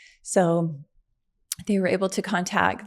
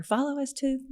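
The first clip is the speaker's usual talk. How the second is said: higher-pitched retold instructions; giving instructions; 7 semitones higher than usual. Injured mother instructs her daughter from the ambulance, higher retold instructions. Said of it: "just giving her all these instructions".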